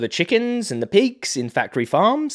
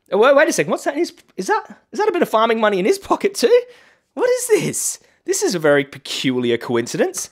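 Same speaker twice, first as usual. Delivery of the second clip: much higher, hammed-up gasp of discovery; mock surprise; 10 semitones higher, 3 dB louder than usual. Vlogger mocking Rishi over farming money, hammed-up surprise. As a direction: pretend you just spotted a bribe.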